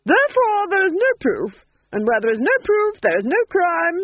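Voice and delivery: high, loud stress on each "no"; triumphant duchess. Acted. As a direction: hammer every "no" coldly. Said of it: "said the Duchess".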